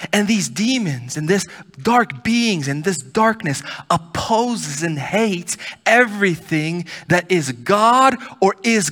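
fiery pulpit preaching; preaching at full force. Acted. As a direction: hammer each phrase home to the congregation. Preaching builds on demons' purpose.

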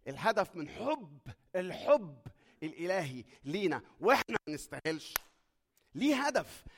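loud, driving sermon delivery; full-force preaching. Acted. Preacher urging the congregation about love's goal, loud and driving. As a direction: hammer the point home from the pulpit.

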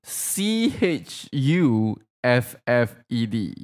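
slow, low letter-by-letter spelling; drawn-out letters, evenly spaced. Shown as a demonstration. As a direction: spell it out deliberately. Spelling the word out slowly.

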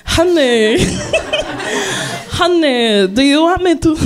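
playing the Greek waitress, a hint of Greek accent; mock Greek accent. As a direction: fake Greek accent, laughing through it. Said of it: "that's not Greek at all".

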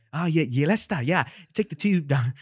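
high, loud, sing-songy British accent; British accent impression. Shown as a demonstration. Guest imitates a comedian's Leicester Square bit, British accent. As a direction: play a lilting British local giving directions. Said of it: "that's how he would do that impression".

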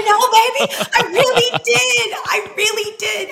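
very high, loud, laughing protest; laughing insistence. Retold or imitated. Insisting to her husband she saved a life, laughing.

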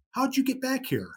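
high, mock demanding question; imitating Gene. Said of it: "And he goes".

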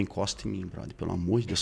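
very low, hushed retold warning; contained warning. Furious man refusing his partner's touch, quiet and controlled. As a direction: act out anger held under a quiet voice. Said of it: "mais sereno eu fico".

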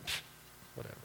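a quick dismissive pfft and shrug-off; dismissive Jonah. Retold or imitated. Sulking prophet brushing off God, dismissive. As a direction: brush the question off lightly. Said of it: "I can kinda see him just kinda like".

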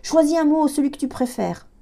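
high, loud cry over the noise; shouted. A narrator's reading. Girl shouting to her brother in the storm. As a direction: yell it across the wind. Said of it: "J'ai hurlé à Thomas".

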